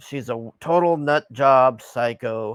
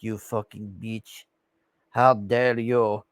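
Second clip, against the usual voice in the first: Melania; 3 semitones lower than usual. Retold or imitated. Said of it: "Melania is just like".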